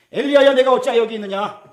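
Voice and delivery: high, loud call from above; God calling out. Acted. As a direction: call his name as God would. God's voice calling Elijah. (하나님이 엘리야를 부르듯 높고 크게)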